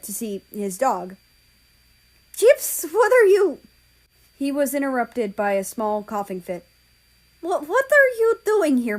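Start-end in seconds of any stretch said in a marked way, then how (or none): 2.4-3.6 high, loud startled cry to the dog; loud shocked cry; startled call. Acted. Surprised owner sees his dog. 7.4-9.0 high, loud stammer of disbelief; loud stunned stammer; stammering surprise. Acted. Stunned to see his dog.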